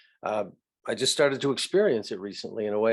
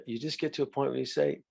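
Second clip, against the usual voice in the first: normal talking voice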